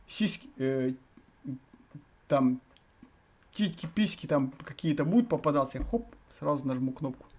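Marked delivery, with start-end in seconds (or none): none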